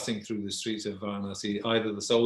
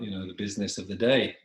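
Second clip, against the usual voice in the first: usual speaking voice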